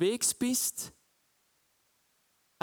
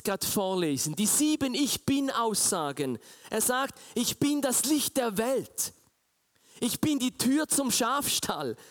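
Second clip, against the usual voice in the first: loud, raised preaching voice; preaching at full force; 7 semitones higher, 4 dB louder than usual. Acted. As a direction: proclaim it like a fervent preacher. Preaching God's words. (laute, feierliche Predigtstimme)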